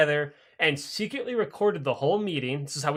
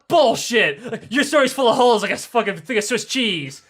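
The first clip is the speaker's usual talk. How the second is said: loud, higher-pitched outburst; indignant accusation; 5 semitones higher, 4 dB louder than usual. Acted. Interrogators rejecting the ambassador's denial, loud indignant outburst. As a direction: call out the lie loudly.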